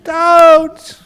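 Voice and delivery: very high, loud braying scream; donkey screams at the farmer to stop. Acted. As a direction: bray in full panic. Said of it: "the donkey really started screaming".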